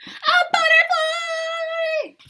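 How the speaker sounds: high, slow, shouted delight; excited reveal. Acted. Teacher revealing the butterfly to her class, thrilled. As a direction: cheer it out like a big surprise.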